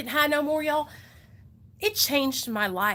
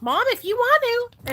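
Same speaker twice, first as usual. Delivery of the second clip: put-on higher, louder kid's reply; imitated son; 11 semitones higher, 5 dB louder than usual. Mother imitating her son's reply in the car, kid voice. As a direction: mimic a child answering mom, pitched up.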